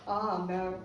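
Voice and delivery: low, lightly voiced "oh no"; imitated audience dismay. Comedian mimicking South Africans in the audience, low dismayed reaction. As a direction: imitate a crowd's knowing dismay. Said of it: "South Africans in the audience just go".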